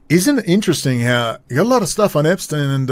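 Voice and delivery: ordinary talk, nothing marked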